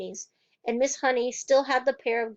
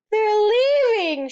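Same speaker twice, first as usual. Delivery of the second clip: very high, loud breathless cry; cried out; 10 semitones higher, 8 dB louder than usual. A narrator's reading. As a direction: blurt the news. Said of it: "she cried".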